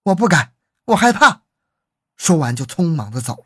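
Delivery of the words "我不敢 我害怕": higher, louder protest of fear; raised, fearful character voice; acted frightened refusal. A narrator's reading. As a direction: back away from the request, voice rising. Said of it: "低下头说".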